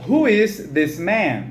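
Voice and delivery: "Who is this man?" at half speed; slow model reading. Shown as a demonstration. Teacher to students repeating the question, slow model. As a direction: separate the words for learners.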